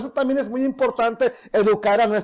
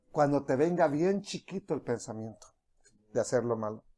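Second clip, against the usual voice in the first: much lower and softer, almost breathed; a quiet tempting inner voice; tempting whisper; 10 semitones lower, 10 dB quieter than usual. Acted. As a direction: murmur it like a sly inner thought. Preacher voices the tempting thought.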